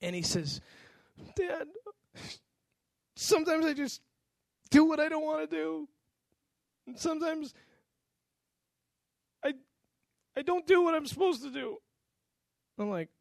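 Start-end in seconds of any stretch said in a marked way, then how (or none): 1.3-11.8 put-on higher, tearful confession of a boy; crying son. A son confessing to his dad, teary and high. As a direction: let a child's words catch between pauses. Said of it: "And then he starts to cry".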